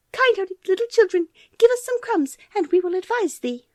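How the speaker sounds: very high, chirpy bird voices; chirping birds. Acted. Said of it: "chirped to the children".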